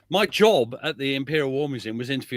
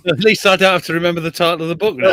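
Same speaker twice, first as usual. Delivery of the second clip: laughing; 4 semitones higher, 8 dB louder, faster than usual. Real, not acted.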